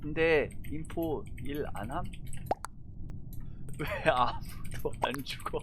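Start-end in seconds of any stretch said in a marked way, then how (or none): none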